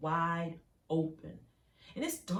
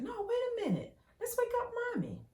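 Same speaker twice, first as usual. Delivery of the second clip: high, fast, eerie sing-song coaxing; falsely sweet, pitched way up; sinister coaxing father; 3 dB louder, faster than usual. Retold or imitated. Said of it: "he was like".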